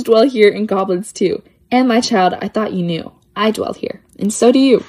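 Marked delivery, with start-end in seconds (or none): none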